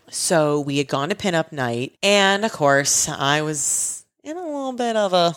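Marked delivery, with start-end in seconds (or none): none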